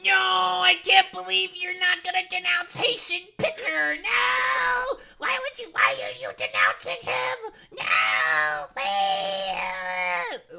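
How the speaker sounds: high, loud, mock-crying shout; mocking whiny Cuomo. Streamer mocks Cuomo by whining loudly at Mamdani. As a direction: wail it like a sore loser throwing a tantrum.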